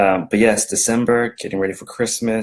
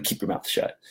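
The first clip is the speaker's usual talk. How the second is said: hushed punchline; 9 dB quieter than usual. Acted. Whispered joke punchline.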